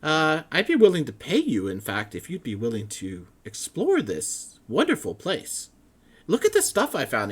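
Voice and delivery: high, loud, flustered scholar voice; excited scholar. Acted. Matrius offers payment.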